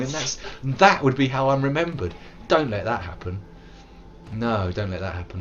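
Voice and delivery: ordinary delivery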